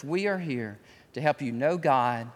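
normal talking voice

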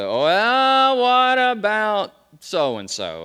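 high, loud, slow mock questioner; caricatured doubter's whine; mocking objector. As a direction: ham up the skeptic's objection. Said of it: "here comes the".

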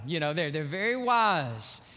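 usual speaking voice

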